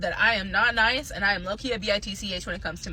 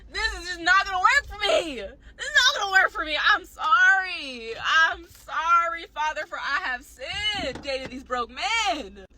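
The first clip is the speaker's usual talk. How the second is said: mock sobbing confession, higher; mock crying; 5 semitones higher, slower than usual. Confessing to a priest about broke men, mock crying. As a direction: wail it like a hammed-up confession. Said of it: "mock crying confession".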